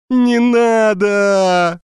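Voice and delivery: high, very slow, tearful begging cry; whiny pleading wail. Acted. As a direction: wail like a terrified child. Beaten thief begs for mercy.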